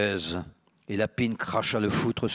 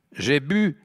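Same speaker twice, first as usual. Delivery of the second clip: put-on loud drunk girl; 8 semitones higher, 7 dB louder than usual.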